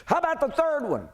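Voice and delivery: a priest eager to have his witnesses lined up; high priest. Acted. Preacher voicing the high priest at the trial, high and insistent. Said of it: "And the high priest says".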